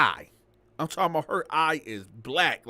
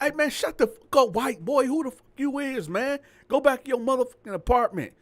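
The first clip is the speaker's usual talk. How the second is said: mock angry yell; 8 semitones higher, 4 dB louder than usual. Car driver cursing at the landlord.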